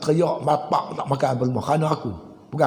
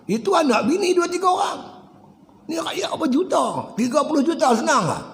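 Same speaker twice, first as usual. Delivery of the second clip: much higher, rising shout of alarm; preaching outburst; 10 semitones higher than usual. Real, not acted. Preacher warning the crowd about rulers, a high shout. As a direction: hammer home the stakes.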